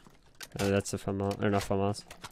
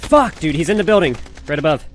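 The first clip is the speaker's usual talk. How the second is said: loud, much higher urgent callout; urgent shout; 6 semitones higher, 7 dB louder than usual. Real, not acted. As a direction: shout the enemy position. Urgent callout of enemy position.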